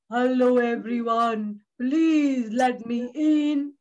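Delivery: low, slow bear voice; big bear. Acted. Bear greets the animals in the mitten, low and slow. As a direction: play a big lumbering bear, drawn out. Mister Bear arrives and asks.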